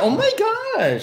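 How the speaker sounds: high, slow, laughing squeal; squealing surprise. Real, not acted. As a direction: gasp it out in delight. Surprised at many likes.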